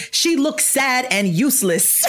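put-on high, slow little-kid voice; a lightly voiced toddler, said slowly; child's voice. Mom quoting her daughter in a slow kid voice. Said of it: "Noah looked at it emphatically and said".

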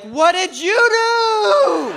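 very high, drawn-out panicked wail; freaking out. Retold or imitated. Buddies seeing him with a daughter, freaked-out shriek. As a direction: shriek it in mock horror, stretching the last word. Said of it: "They started freaking out".

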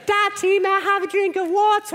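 put-on high, small child's asking voice; sweet child voice. Child asks Daddy for water, high and sweet. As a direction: play a polite little kid asking for water. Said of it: "They'd be like".

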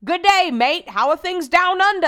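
loud, high mock-Australian greeting; bad Australian accent. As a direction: holler a terrible put-on Australian accent. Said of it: "I do the worst impression of Australian".

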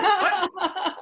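high, laughing disbelief; a yelp of stunned surprise; finding out he's the one traded; shocked what. Retold or imitated. Learning he was traded.

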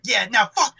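high panicked yelps. Retold or imitated. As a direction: squeal it in sudden panic.

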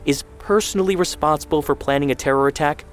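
high, indignant questioning that keeps climbing; incredulous challenge. A narrator's reading. Mother confronting son, incredulous and climbing.

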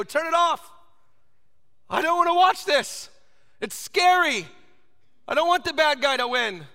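put-on high, loud child's protest; scared little kid. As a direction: plead like a frightened kid at a scary movie.

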